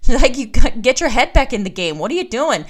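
half-laughing, playfully exasperated call-out; laughing exasperated scold. Retold or imitated. Mentor calling her out, loud and laughing. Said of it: "she's like".

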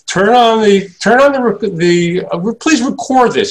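lightly imitated musician, pitched well up; halting, high-pitched request. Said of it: "He said".

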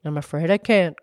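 low fish voice, lightly gurgled; gurgling fish. A narrator's reading. Fish declining to help the girl, low gurgling voice. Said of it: "gurgled the fish".

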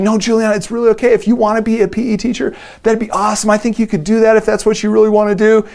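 backtracking dad. Retold or imitated. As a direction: soothe your crying daughter, flustered and high. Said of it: "And so I'm backtracking".

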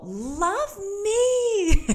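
high, loud, laughing plea of love; sister demanding love. Retold or imitated. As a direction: beg for a hug like a big sister. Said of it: "she always used to go up to me and go".

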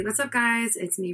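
ordinary talk, nothing marked

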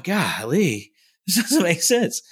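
bewildered golly. Retold or imitated. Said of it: "and you're just going".

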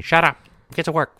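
loud barked order in flat American voice; mock shout, no German accent at all; plain American drill sergeant. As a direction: bark it like an all-American officer. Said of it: "He's saying".

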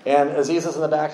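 usual speaking voice

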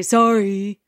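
mock sulky kid's sorry; imitating a child's fake apology; insincere apology. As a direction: drag out a grudging, sarcastic sorry. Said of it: "that oh so genuine".

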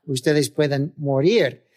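normal talking voice

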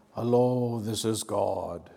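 slow, drawn-out divine announcement, hammed-up; mock voice of God. Preacher joking about how God speaks, mock grand voice. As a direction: stretch the vowels like a pretend almighty. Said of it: "Does he speak like".

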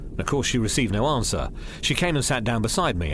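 plain reading voice

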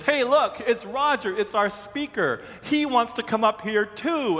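high-pitched imitation of an excited kid; imitated kid calling out excitedly; excited eighth grader. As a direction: pipe up like a thrilled middle schooler. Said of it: "one of the eighth graders sees me".

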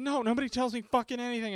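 high, loud, fast comeback; retold mad retort. Arguing after getting electrocuted, mad retort. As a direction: fire back in annoyance. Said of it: "And then I got mad".

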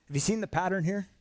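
ordinary talk

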